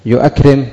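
يؤكرم as "yu-akrim", nothing dropped; hamza kept in يؤكرم. Shown as a demonstration. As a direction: keep the glottal "a" after "yu" in يؤكرم. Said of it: "لم يحذف شيء". (يؤكرم بإثبات الهمزة وسكون الميم)

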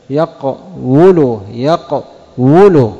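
يقول said slowly with the u on the w; yaq-wu-lu, slowly, with the w sounded; original form yaqwulu. Shown as a demonstration. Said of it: "الضمة التي على الواو سببت ثقلا". (يقول على الأصل: يَقْوُلُ)

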